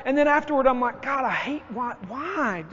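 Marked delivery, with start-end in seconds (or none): 1.0-2.6 self-directed anger. Retold or imitated. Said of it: "I'm beating myself up".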